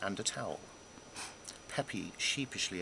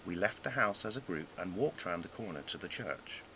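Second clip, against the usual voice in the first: usual speaking voice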